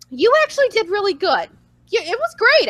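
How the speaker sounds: high, singled-out praise in mimicry; high-pitched retold compliment; reassuring boss. Acting out boss softening the news.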